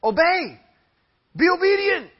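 "obey" and "obedient" pitched high and loud; emphatic obey. Shown as a demonstration. Preacher pressing the congregation to obey, stressed high and loud. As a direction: drive home "obey" like a command. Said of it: "The emphasis is".

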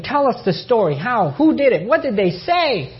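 high, excited burst of questions, rising in pitch; eager questioning. Retold or imitated. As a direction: imitate astonished friends demanding every detail. Friends eagerly asking for the story.

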